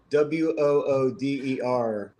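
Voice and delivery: ordinary talk, nothing marked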